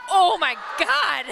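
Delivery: high, slow gasp of disbelief; real amazement. Real, not acted. Commentator watching the U S game, amazed and delighted. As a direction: exclaim it drawn out, about to laugh. Commentator reacts to big play.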